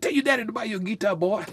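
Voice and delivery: imitating Creole uncle. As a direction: rattle it off fast like the uncle urging the boy. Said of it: "He said".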